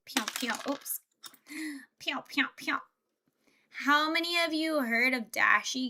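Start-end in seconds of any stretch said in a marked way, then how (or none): 0.1-0.5 quick little "pew" shots; toy gun noises. Pretend. Streamer playing with a gun-like Lego piece, gun noises. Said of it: "it's like a gun". 2.0-2.8 soft, half-whispered laser shots; toy gun noises. Pretend. Streamer playing with a gun-like Lego piece, soft gun noises. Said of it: "it's like a gun".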